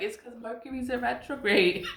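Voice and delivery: laughing, put-on indignation; mock upset. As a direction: imitate upset people blaming the planets. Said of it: "everybody's mad and upset".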